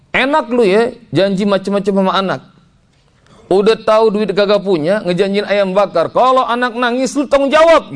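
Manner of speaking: high-pitched, building retold scolding; scolding wife. Wife berates husband for promising chicken without money, high angry scolding. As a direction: scold him harder, rising to a near-shout. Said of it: "istri saya ngomong gini".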